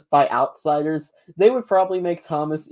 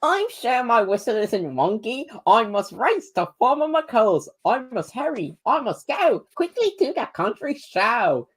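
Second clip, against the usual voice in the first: squeaky high-pitched engine voice; high helium-style cartoon train voice; Thomas impression; 7 semitones higher than usual. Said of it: "my impression of ... Thomas".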